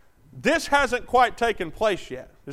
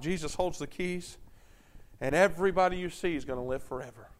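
tender preaching; 4 semitones lower than usual. Acted. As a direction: soothe them with promises of heaven. Soft comforting sermon on heaven.

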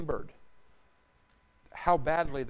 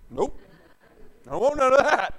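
a child's defiant refusal, voiced higher and louder; 7 semitones higher, 7 dB louder than usual. Preacher voicing a child refusing his parent, defiant. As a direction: play the stubborn kid talking back. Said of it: "And he'll say".

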